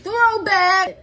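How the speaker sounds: high, punchy call-out; high mock announcer yell; announcing shout. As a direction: holler it like a caption. Calling out the video caption.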